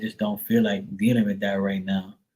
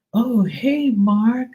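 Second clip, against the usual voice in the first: much higher, fake-surprised sing-song hello; imitating the daughter; 11 semitones higher, much slower than usual. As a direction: pretend she just happened to bump into him. Mimicking the daughter's greeting.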